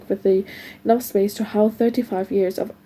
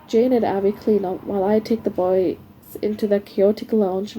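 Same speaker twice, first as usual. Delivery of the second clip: ordinary delivery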